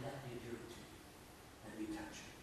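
soft voice dropping to a whisper; tender hushed invitation. Acted. Preacher voicing God asking to touch you, hushed and tender. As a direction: invite softly, almost whispering.

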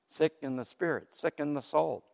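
usual speaking voice

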